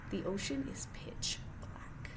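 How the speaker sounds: slow, soft whisper, voice off; dramatic whisper. Acted. Reader whispering the dark ocean fact to the child. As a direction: hush it like a spooky secret.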